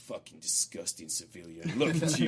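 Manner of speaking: low contemptuous mutter; disgusted soldier sneer. Retold or imitated. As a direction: sneer at a scruffy civilian. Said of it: "looking at me like".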